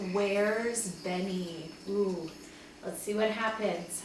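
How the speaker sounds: ordinary delivery, nothing marked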